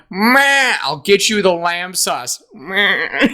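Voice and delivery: high bleating ram voice. Retold or imitated. Ram-man waiter answering Stella, high bleating voice. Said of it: "Mister Ram".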